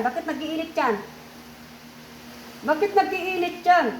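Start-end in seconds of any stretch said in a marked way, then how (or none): 2.6-3.9 higher, forceful repeat of the question; demanding. Real, not acted. Healer demands why the child is burning up, demanding. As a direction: press hard with raised pitch.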